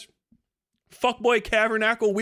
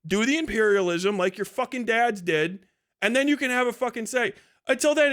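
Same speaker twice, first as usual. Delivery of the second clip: ordinary delivery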